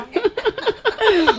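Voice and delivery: a burst of high laughter. Real, not acted. Amused high laugh at the child's fate. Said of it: "जो हालत होने वाली है".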